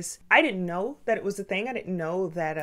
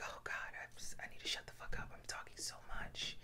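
breathy whisper, much softer, scolding herself; anxious inner whisper; 19 dB quieter, faster than usual. Retold or imitated. Catching herself talking too much, self-scolding whisper. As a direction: whisper it in shame to yourself.

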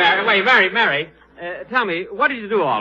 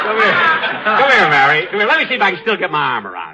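excited playful shout; 6 semitones higher, 7 dB louder than usual. Acted. Playful grab at Mary.

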